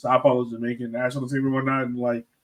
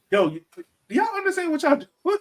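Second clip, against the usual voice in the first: high falsetto disbelief, pitched way up; incredulous mock quote. Journalist mocking federations in a high incredulous squeak. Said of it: "you're like".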